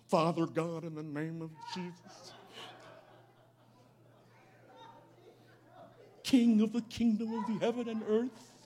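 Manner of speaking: low, solemn, formal prayer voice; mock King James prayer. Preacher mocking stiff old-fashioned prayer, solemn and formal. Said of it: "Who talk like that? ... That's King James".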